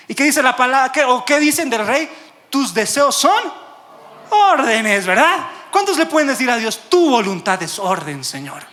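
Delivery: loud, rousing declaration of obedience; fervent preaching. Acted. Preacher leading people to tell God they obey, fervently. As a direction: launch each phrase high and loud, then drop.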